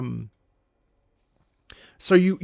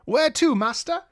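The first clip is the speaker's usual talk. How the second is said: put-on British accent; 12 semitones higher than usual. Teacher playing a British worker hauling bags, British accent. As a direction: play an eager British porter. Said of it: "that was my attempt at a British accent".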